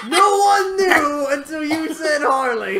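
loud laughing protest; laughing shout. Real, not acted. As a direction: laugh it out loudly, half-shouting.

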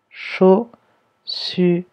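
"Chaussure" in three slow beats, CHAU, SSU, RE; "Chaussure" stretched out, each syllable on its own. Shown as a demonstration. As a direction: sound out "Chaussure" with pauses and a voiced final RE. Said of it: "c'est dans la première syllabe".

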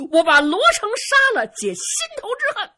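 high, loud oath of revenge; vengeful cry. Acted. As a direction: swear it to the fallen father. Son vows revenge for his father.

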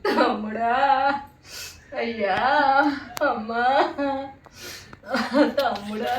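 drawn-out sobbing calls to the dead; long-vowelled lament with gaps between cries; mock funeral wail. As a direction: imitate a mourner's wail in broken calls. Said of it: "నేను చెప్పనా ఏం ఏడ్చాను అంటే".

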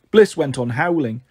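plain reading voice

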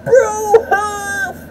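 very high, drawn-out squawk; yelled sign-off. Pretend. Vlogger ending the video with a catchphrase, high yell. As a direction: let out a high goofy yell.

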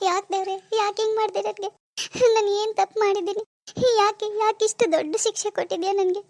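tearful lament broken by sobs; crying. Acted. Daughter alone asks God why she is punished, crying. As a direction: question God through your tears. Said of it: "ಯಾಕ್ ಅಳ್ತಾ ಇದ್ಯಾ".

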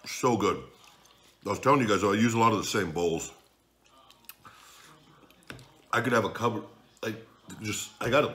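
chewing while talking; eating between words; mouth full. Real, not acted. Seen 3: Eats from fork before; talks with mouth seemingly partly full.

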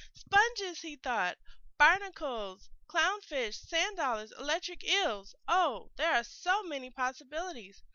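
bursting with plans for the new shell; excited. A narrator's reading. Crab dreams of new house.

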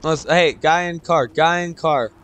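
urgent call-out. Real, not acted. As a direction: warn teammate about the car. Urgent warning call to teammate.

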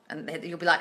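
ordinary talk, nothing marked

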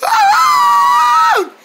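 very high-pitched shriek; sudden piercing squeal in place of an answer. Acted. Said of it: "Why you keep shrieking like that".

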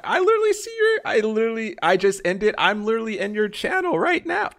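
very high, loud comic squeal; mock outraged protest. Streamer insisting to a friend they're in the channel, mock outburst. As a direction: protest in an over-the-top high voice.

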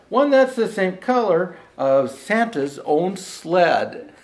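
measured, rhythmic storybook reading; lilting holiday verse reading; poem recitation. Acted. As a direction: read it like a bedtime Christmas rhyme. Rhymed Christmas poem read aloud.

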